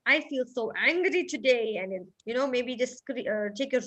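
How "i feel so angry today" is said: angry. Shown as a demonstration. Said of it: "or then".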